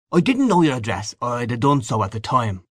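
hearty congratulating Gallagher. Acted. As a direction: offer expansive good wishes to an old friend. Gallagher congratulates his friend.

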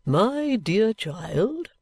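very slow, grandly drawn-out address; self-important patriarch; pompous old father. A narrator's reading. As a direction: bestow each word like a blessing.

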